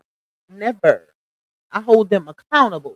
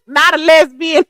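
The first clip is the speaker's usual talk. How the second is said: high, loud laughing disbelief; 6 semitones higher, 5 dB louder than usual. Real, not acted. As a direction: crack up on the words. Cracks up at viewer's comment.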